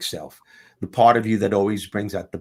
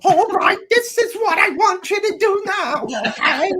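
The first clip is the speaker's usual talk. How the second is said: much higher, louder squawky housewife voice; Edith Bunker; 5 dB louder than usual. Retold or imitated. Author imitating how Bob talks to her, as a high Edith Bunker. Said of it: "Bob talks to me like Edith Bunker".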